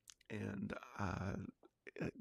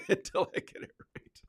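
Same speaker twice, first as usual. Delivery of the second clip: laughing; 12 semitones higher, 7 dB louder than usual. Real, not acted. Laughing about his own French accent.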